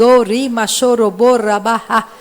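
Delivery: brief burst of loud glossolalia; praying in tongues. Real, not acted. Preacher urging them to keep speaking, praying in tongues. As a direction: let tongues pour out at preaching volume.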